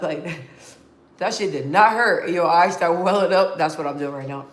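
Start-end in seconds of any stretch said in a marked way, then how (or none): none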